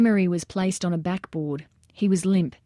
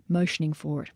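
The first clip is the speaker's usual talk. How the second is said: usual speaking voice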